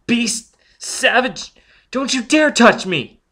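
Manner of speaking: high, loud, slow outraged yells; shrieking rage; furious outburst. Acted. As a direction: hurl the insults at him. Robin slaps Foyle.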